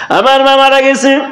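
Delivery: high, chanted grief; wailed lament. Acted. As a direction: intone the loss on a high mournful note. Son laments his mother's death.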